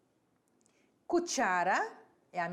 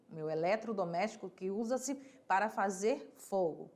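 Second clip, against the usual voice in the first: ordinary talk, nothing marked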